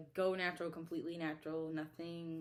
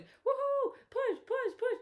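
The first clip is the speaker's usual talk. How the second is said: much higher cheery chant; mocking cheerleader nurses; 5 dB louder, slower than usual. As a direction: parody the staff cheering her on. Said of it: "all they doing is".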